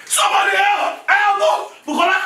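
high panicked cries for help; husband crying out for help as his wife collapses; panicked call for help. Acted. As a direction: yell for help, frightened.

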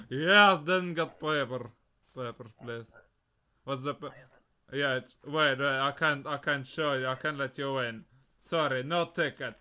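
put-on Russian accent. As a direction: play a border official with a Russian accent. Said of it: "where's my Russian accent?".

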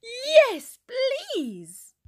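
high, thrilled acceptance; gasped delight. A narrator's reading. Dylan offered the astronaut part, gasping with excitement. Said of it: "Dylan gasped".

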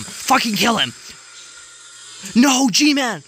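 loud, high shouts at the screen; worked-up fan shouting; frantic yelling. Real, not acted. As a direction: yell as if the fighters could hear.

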